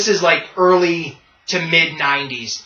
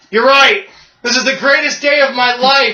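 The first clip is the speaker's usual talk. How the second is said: higher, louder burst of glee; gleeful admission; 5 semitones higher, 3 dB louder than usual. Retold or imitated. Lex Luthor confessing his joy at the funeral, gleeful. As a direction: drop the act and gloat. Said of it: "He's like".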